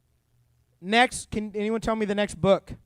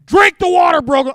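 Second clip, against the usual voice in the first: high, very loud exasperated yell; mad shout; 8 semitones higher, 11 dB louder than usual. Acted. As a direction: blow up and bellow it as an order. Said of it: "Moses was that mad".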